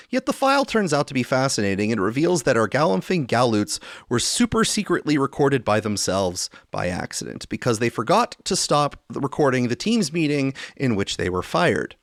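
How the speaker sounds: normal talking voice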